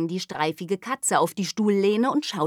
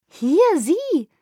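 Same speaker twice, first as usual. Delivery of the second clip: excited; 12 semitones higher, 7 dB louder than usual. Acted. Girl points eagerly.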